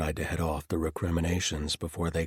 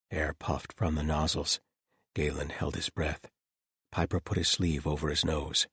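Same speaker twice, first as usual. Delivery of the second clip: plain reading voice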